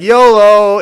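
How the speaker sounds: loud, high, mock daredevil whoop; carefree yolo. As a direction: shout it as you leap without thinking. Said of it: "Burryaga's like".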